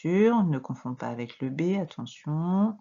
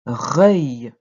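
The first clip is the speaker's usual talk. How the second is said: lower, loud, drawn-out syllable; dictation; 4 semitones lower, 11 dB louder than usual. Shown as a demonstration. Teacher dictating a syllable to a pupil, low, loud and drawn out. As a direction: sound the syllable out firmly for writing. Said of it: "Je répète".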